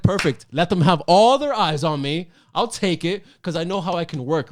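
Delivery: high, loud and eager; excited. Retold or imitated. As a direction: jump at the second chance, thrilled.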